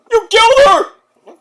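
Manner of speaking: high, loud, slow yell; loud fan outrage; outraged shout. Real, not acted. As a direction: scream it at the wrestler in mock horror. Outraged reaction to the spot.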